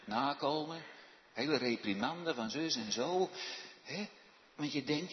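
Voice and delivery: ordinary delivery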